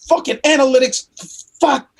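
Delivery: high, exasperated cursing; angry outburst. Retold or imitated. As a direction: spit out the curse in frustration. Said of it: "I'd get so angry and so pissed".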